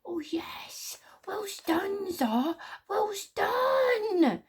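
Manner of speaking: excited high squeal; Squeeze Juice. A narrator's reading. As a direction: squeal tiny, drawn-out praise in a very high voice. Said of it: "squealed Squeeze Juice excitedly".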